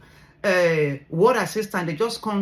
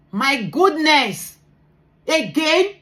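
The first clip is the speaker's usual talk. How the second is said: retold disbelief; 7 semitones higher, 5 dB louder, much slower than usual. Retelling the second bad news.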